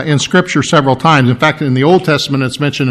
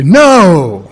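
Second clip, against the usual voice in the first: long, forceful "No"; emphatic no; 4 dB louder than usual. Acted. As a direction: protective parent's firm "No". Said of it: "emphatic no".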